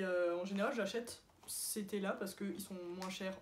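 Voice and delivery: ordinary talk, nothing marked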